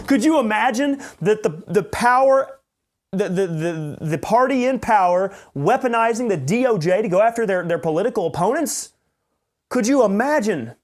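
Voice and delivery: mock the anchors' disbelief; hammed-up sarcastic incredulity; mock outrage. Mocking the news anchors' outrage.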